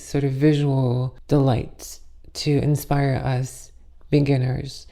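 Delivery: ordinary talk, nothing marked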